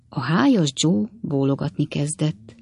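plain reading voice

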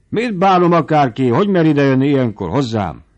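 irritated shout at the late visitor; angry shout. A narrator's reading. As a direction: snap it out, offended anyone would come.

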